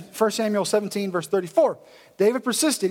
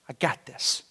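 cool confidence; 7 semitones lower, 6 dB quieter than usual. Acted. David's cool reply to mockery.

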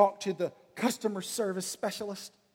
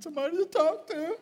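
high, tearful comic breakdown; mock tearful; 9 semitones higher than usual. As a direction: break into a high-pitched fake cry. Yelled-at agent breaks down on call.

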